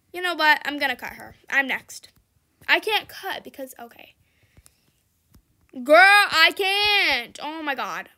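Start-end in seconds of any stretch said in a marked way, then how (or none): 5.8-7.4 exasperated. Real, not acted. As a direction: drag it out in disbelief. Exasperated at the blocking car.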